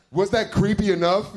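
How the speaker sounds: ordinary talk, nothing marked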